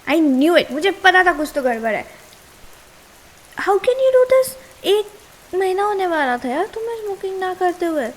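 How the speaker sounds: loud, betrayed shout, rising high; angry outburst. Acted. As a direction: blow up at him for lying. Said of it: "Yes, I am mad".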